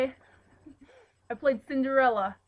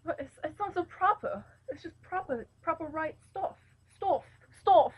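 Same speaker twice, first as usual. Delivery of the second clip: higher-pitched mock English accent; 5 semitones higher than usual. Shown as a demonstration. Storyteller protesting it is not so proper, attempted English accent. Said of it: "tell it in an English accent".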